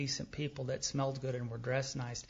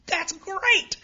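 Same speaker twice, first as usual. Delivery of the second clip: much higher, louder mock delight; gleeful shout; 10 dB louder than usual. Sponsor hearing his confession, gleefully yelling. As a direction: burst out as if overjoyed. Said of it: "pounding my back and laughing".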